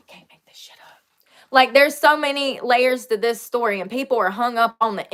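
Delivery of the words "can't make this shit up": barely voiced, whispered under the breath; whispered aside. Real, not acted. Host muttering to self about an unbelievable story, whispered aside. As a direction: whisper it to yourself after a sigh. Exasperated aside after a sigh.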